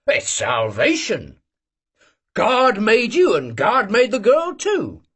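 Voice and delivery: put-on high, slow, earnest old man; old Cossack preaching. Old Cossack lectures the young cadet about love, high and slow. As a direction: lay out his creed with relish.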